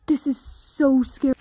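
ordinary talk

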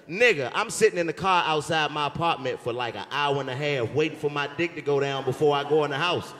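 ordinary talk

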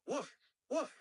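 two high, separate woofs; high-pitched imitation dog woofs; dog bark. Shown as a demonstration. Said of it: "dog in this room barking".

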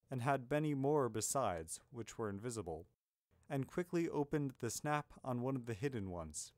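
ordinary delivery, nothing marked

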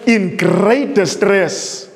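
very slow, every word weighed; slow solemn reading. Shown as a demonstration. Preacher reading the quote to the congregation, slowly. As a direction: read the prophecy slowly, letting it sink in. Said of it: "listen to what she says".

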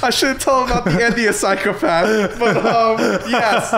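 high, loud real laughter through the words; laughing. Real, not acted. Host reacting to friend wanting to live forever, laughing. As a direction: talk through helpless laughter.

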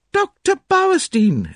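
very high, drawn-out cry of a name; surprised exclamation. A narrator's reading. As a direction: exclaim at an unexpected arrival, pitched right up. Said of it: "exclaimed Cynthia".

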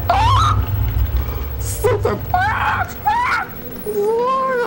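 very high, panicked squeals; terrified shrieking. Real, not acted. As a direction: shriek out in real fright. Jump scare in horror game.

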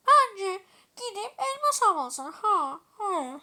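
put-on loud villager voice with grunts; disguised villager voice. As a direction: play the villager, hammed up. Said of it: "sesimi değiştirip ... köylü olarak". (köylü sesi taklidi)

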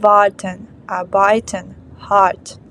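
Warten, arbeiten, hart with the R almost vanishing, very soft; the R in Warten, arbeiten, hart melted into the vowel; soft R demo. Shown as a demonstration. Teacher showing students the soft German R in Warten, arbeiten, hart. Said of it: "Вона стає дуже-дуже м'якою".

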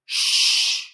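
long shushing sound; horse's shush. Acted. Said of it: "the horse tells him to".

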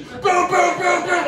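imitated banging on the stall door; pounding door knocks.

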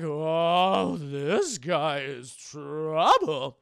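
low, very slow, loud drawl; old master. Retold or imitated. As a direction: stretch it out like a wary master. Old master sizing him up.

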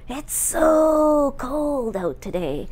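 put-on drawn-out teen talk, high and slow on so; teenage kid. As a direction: imitate a teenager, stretching so way up. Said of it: "But what my kids were saying was".

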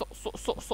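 "ص" breathed out unvoiced; whispered letter sound. Shown as a demonstration. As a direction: hiss out "ص-، ص-" softly. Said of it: "شايفين أنا بنطق حرف ايه". (نطق "ص" همسًا)

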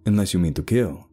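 grave caution; warning. Acted. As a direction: finish the warning about the weapon. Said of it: "Sebastian warned".

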